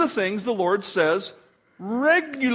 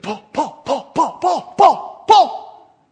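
climbing from normal to a high shout; hammed-up pupil clamouring for attention; mock eager volunteer; 7 semitones higher, 10 dB louder, much slower than usual. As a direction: beg to be picked, each call louder and higher. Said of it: "Timothy didn't raise his hand".

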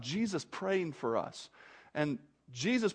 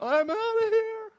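high, squealed mock escape cry; comic panicked exit; 4 dB louder than usual. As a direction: squeal it like someone bolting. Imitates naked man fleeing.

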